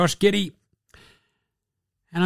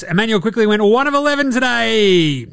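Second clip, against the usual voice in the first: normal talking voice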